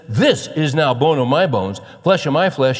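loud, high, amazed exclamation; Adam bursting out in excited disbelief. Acted. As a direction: proclaim it loudly, thrilled beyond belief. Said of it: "He's excited".

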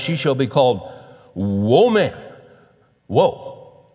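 loud, stunned "whoa, man"; hammed-up astonishment; awestruck. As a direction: draw out woman like an amazed whoa. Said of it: "He can't believe this".

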